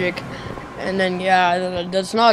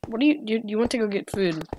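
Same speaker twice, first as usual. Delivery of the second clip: normal talking voice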